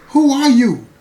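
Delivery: high, loud shocked question; shocked demand. Retold or imitated. Inmate hearing another man on her phone, shocked and loud. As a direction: demand the stranger's name.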